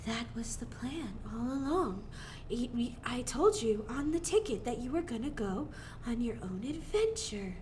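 soft, mysterious whispered reveal; Willy Wonka whisper. Retold or imitated. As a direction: whisper it like a secret plan. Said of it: "Willy Wonka comes out".

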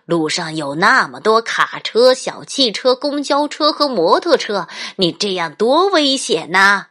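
loud, rising lecture on danger; scolding dad. Acted. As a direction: build up the scolding. Dad scolds runaway child.

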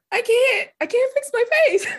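put-on high, childlike whine of protest; whiny protest. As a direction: play the helpless kid who can't stop frowning. Her childish protest to parents.

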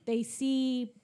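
normal talking voice

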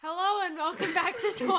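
big high, loud show greeting; over-the-top podcast hello; loud intro. Real, not acted. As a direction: shout the welcome, tipping into laughter. Show greeting, breaking into laughter.

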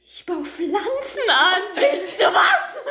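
loud, high, slow parody, laughing; hammed-up drug-grower offer, stretched out; mock dealer boast. Mocks the odd guy's boasting.

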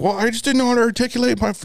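high, rushed excuse; mimicked girlfriend. Girlfriend explaining why she stayed silent earlier, high rushed mimicry. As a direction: imitate her fast and high.

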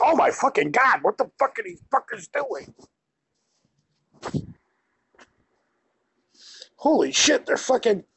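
high, shocked exclamation; appalled outburst. Retold or imitated. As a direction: react to the video in disbelief. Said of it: "You're like".

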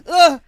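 high, very loud yelp; acted cry as the legs get crushed; short explosive cry of pain; pained grunt. Legs run over by car.

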